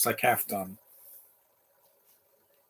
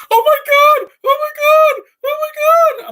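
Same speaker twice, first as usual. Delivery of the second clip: very high, loud panicked squeal; Morty; 12 dB louder, slower than usual. Acted. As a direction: shriek it like a frantic cartoon boy. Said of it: "he sounds like Morty from Rick and Morty".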